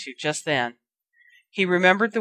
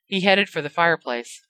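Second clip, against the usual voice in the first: ordinary delivery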